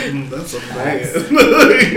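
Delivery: drawn-out words through a laugh; slow amused mock quote. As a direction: savour the line while laughing. Quoting the movie line, laughing.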